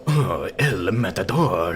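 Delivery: slow, loud, exaggerated Spanish accent; mock Spanish accent. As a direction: ham up a Spanish voice, loud and drawn out. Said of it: "like, a Spanish voice".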